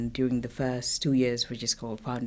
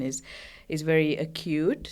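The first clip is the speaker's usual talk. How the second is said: ordinary talk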